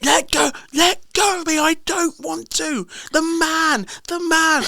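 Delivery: put-on little girl refusing to go past the scary man; scared little girl. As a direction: squeal it like a frightened kid being dragged. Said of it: "a little Irish ginger-haired kid".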